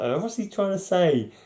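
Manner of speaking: high-pitched exasperated puzzlement; baffled reader. Retold or imitated. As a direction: voice a confused reader's mock-frustrated question. Voices himself puzzling over the dialogue.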